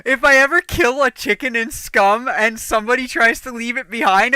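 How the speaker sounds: ordinary delivery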